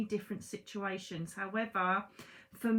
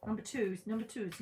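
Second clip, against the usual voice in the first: normal talking voice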